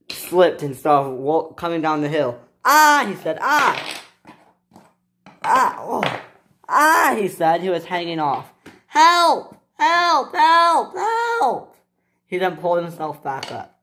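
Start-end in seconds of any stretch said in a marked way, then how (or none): 2.6-7.1 startled cries. A narrator's reading. Slipping down the hill. 8.9-11.7 high, loud repeated cries for help; cry for help. A narrator's reading. Hanging off a hill.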